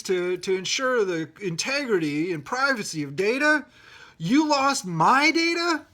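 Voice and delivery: reenacting the outrage at the agency, slow and loud; indignant complaint. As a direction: scold them in disbelief. Retold angry complaint.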